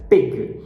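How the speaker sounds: pig with no air after the P; loud, short pig with a dry P; unaspirated P. Shown as a demonstration. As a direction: mistaken unaspirated start on pig. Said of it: "Tá errado essa pronúncia, não é".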